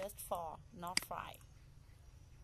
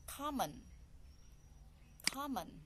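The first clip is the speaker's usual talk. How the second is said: "Common" with a high first syllable, then falling; stress on first syllable; 5 semitones higher than usual. Shown as a demonstration. Teacher repeats "Common" for learners to find the stress, first syllable stressed. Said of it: "the first syllable".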